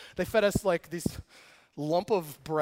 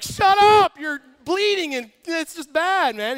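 high, loud exasperated yell; a prisoner yelling at Paul to stop praying; annoyed shout; 8 semitones higher, 9 dB louder, slower than usual. Retold or imitated. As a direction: shout him down, fed up. Fellow prisoner yells at Paul.

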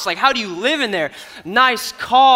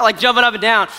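usual speaking voice

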